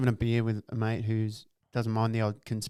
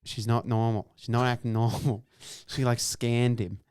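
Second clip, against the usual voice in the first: ordinary talk, nothing marked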